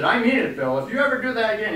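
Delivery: angry man storming back to finish the threat, shouting; spitting fire. Retold or imitated. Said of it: "he was just spitting fire".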